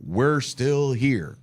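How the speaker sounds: very slow, drawn-out declaration; slow, deliberate quoted line; quoted defiant catchphrase. Retold or imitated. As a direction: stretch each word out for weight. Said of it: "I almost feel like Tom Brady".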